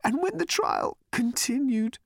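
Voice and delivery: high, thin, tearful voice; weeping whisper. A narrator's reading. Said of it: "in a weeping whisper".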